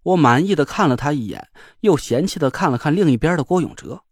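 ordinary delivery, nothing marked

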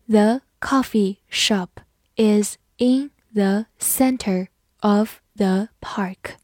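slow, word by word, the last word fading out; slow reading. Shown as a demonstration. Teacher reads slowly to the class, slow reading. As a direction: read it slowly for learners. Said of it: "好，慢慢来读".

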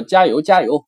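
loud 加油 加油 shouted up high; teacher shouting a cheer. Shown as a demonstration. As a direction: shout encouragement. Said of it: "或者说".

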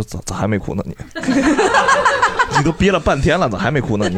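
teasing him through laughter, quoting her; giggling mock question, can't keep a straight face; laughing girlfriend. Quoting his girlfriend's teasing, laughing as she asks. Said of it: "然后我女朋友说".

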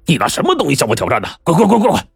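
sneering bandit shouting him away; contemptuous bully. Acted. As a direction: chase the coward off, shouting 滚. Bully scolds the swordsman.

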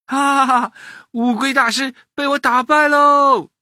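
put-on high, loud gloating boy; laugh, then crow the victory; triumphant laughing. A narrator's reading. Boy has beaten the turtle master, laughing and crowing. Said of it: "玄玄笑了".

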